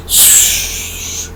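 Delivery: long hushing "shh" for spray; drawn-out voiceless swoosh; jet ski whoosh. Acted. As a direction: imitate a jet ski rushing past. Imitates jet ski passing.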